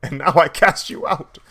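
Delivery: loud mock-regal decree; hammed-up stern king; stern father Odin. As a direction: pronounce the sentence like a king. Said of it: "He's like".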